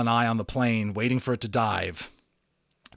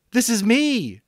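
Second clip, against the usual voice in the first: much higher, much louder rushed answer; eager reply; 12 semitones higher, 8 dB louder than usual. Acted. Boy answering the radio voice, eager and loud. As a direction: grab the chance, blurt it out.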